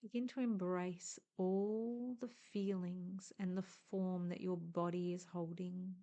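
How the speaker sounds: normal talking voice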